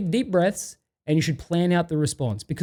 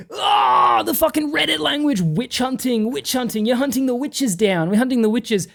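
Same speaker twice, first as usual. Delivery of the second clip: high, mocking put-on voice, with a shouted, much higher start; 6 semitones higher than usual. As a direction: ridicule "witch hunting" in a silly high voice. Mocking the mod's phrase.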